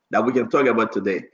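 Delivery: normal talking voice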